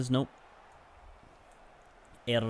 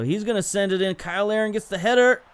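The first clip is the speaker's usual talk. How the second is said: rising, louder play-by-play as the header comes; excited call; 7 semitones higher, 4 dB louder, faster than usual. Real, not acted. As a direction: build the call up to the goal. Goal chance play-by-play.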